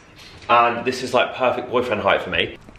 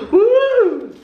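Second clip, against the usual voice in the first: sharp gasp then very high loud woo; gasp, then whoop with delight; 6 dB louder than usual. Real, not acted. Opening the box of baubles, gasp and high whoop.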